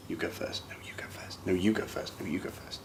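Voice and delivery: hushed mimicking of awkward voices; whispered polite deferring. Group members deferring to each other, whispered politely. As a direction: whisper it quickly, each one passing the turn on.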